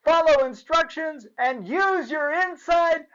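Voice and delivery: high, loud excited shouting; yelling. A narrator's reading. Clark shouts the remembered rules to Joey. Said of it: "Clark yelled".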